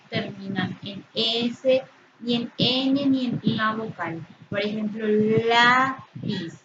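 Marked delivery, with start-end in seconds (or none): 5.1-6.6 "lápiz" with a long, strong LÁ; stress on penultimate syllable. Shown as a demonstration. Teacher giving a llana example, stressed LÁ in "lápiz". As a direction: draw out the first syllable of "lápiz", then drop off. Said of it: "Su sílaba tónica sería la penúltima".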